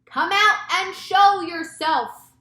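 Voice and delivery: loud, high, slow order; drawn-out police chief's holler; shouted command. A narrator's reading. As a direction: shout it slowly up to a rooftop. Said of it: "shouted the chief of police".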